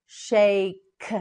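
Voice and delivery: "Shake" stretched, k set apart; slow modelling. Shown as a demonstration. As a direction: draw out "Shake", then release the k. Teacher models word slowly.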